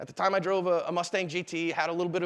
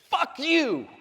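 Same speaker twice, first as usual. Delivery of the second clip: higher-pitched hostile shout; angry yell; 8 semitones higher, 4 dB louder than usual. Retold or imitated. Driver cursing dad out of passenger window, angry yell. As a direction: hurl it like a driver from a car window.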